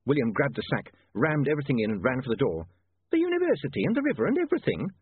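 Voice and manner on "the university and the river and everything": high-pitched nervous landlady. A narrator's reading. Landlady worrying about the city's future, nervous. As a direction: fret in a high voice. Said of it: "said the landlady nervously".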